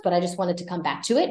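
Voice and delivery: usual speaking voice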